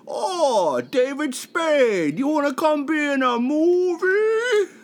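high, whiny slow Sandler voice; goofy squeaky Sandler whine; Adam Sandler impression. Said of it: "give us an Adam Sandler impression".

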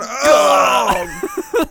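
loud sudden shout; startled shout. Retold or imitated. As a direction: yelp it out in alarm. Acting the grenade explode moment.

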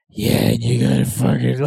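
very low movie tough-guy voice; deep Gran Torino impression; Clint Eastwood. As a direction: drop right down into a put-on character voice. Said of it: "just have that voice".

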